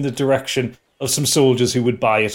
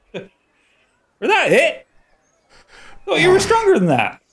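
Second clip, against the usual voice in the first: dwarf voice; 13 semitones higher, 3 dB louder than usual. Acted. Dwarf NPC taunting fallen foe.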